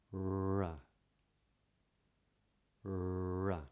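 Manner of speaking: Ra with tongue curled up and lips slightly pouted; modern British R. Shown as a demonstration. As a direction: model the British Ra for repeating. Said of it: "have a go with me".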